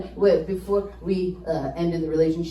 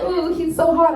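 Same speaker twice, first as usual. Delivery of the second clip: high, louder swooning; swooning women; 8 semitones higher, 5 dB louder than usual. Retold or imitated. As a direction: swoon like a smitten fan. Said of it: "they're like".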